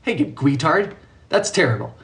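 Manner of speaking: normal talking voice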